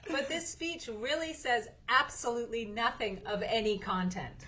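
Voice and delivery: ordinary talk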